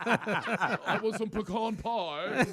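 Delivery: slow, drawn-out snooty millionaire; Thurston Howell. Retold or imitated. Mocking the host's pecan like a snooty millionaire. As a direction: stretch it out like a stuffy rich man. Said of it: "Thurston Howell?".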